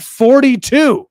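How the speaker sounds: loud, high, drawn-out disbelief; incredulous. Retold or imitated. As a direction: stretch it out in loud amazement. Acting out disbelief at lavish birthday.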